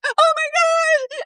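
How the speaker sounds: very high, exaggerated gasp of offence; hammed-up youthful dismay; mock horrified classmate. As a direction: squeal it like a scandalized student. Mimics offended younger classmates' reaction.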